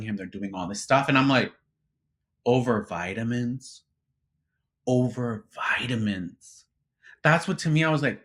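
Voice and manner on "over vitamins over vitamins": slow, drawn-out disbelief; incredulous. Retold or imitated. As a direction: stretch it as if it is absurd. Retold disbelief at petty feud.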